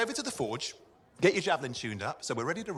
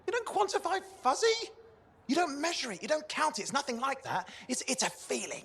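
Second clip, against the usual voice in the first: higher, pleading with the machine; arguing with a stubborn computer, raised and exasperated; exasperated insistence; 9 semitones higher than usual. Acted. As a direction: push back, half annoyed.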